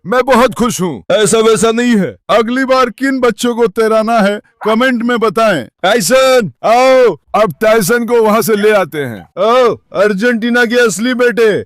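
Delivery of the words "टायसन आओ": calling out. Real, not acted. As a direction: shout the dog's name to come over. Calling dog across yard.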